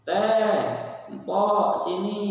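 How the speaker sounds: high, loud summons; calling out. Acted. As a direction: call the household helper over from another room. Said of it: "Kalau kamu manggil pembantu".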